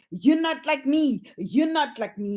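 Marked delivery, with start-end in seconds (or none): none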